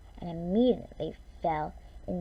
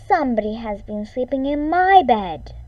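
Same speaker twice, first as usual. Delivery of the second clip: louder grumbling mother bear; grumbling Mama Bear; 5 semitones higher, 7 dB louder than usual. A narrator's reading. Mama Bear finds her flat pillows, louder grumble. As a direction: grumble it, put out. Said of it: "In her medium-sized voice, she grumbled".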